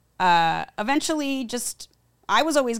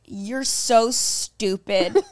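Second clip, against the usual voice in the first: scornful; 2 semitones higher, 3 dB louder, much slower than usual. Retold or imitated. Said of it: "the fact that he was like".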